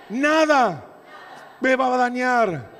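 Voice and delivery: loud and high, hammered home; shouted repeat. Real, not acted. Pastor leads the church in repeating it, shouted. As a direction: shout it again for the congregation to echo. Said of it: "Decí conmigo".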